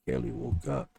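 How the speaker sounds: plain reading voice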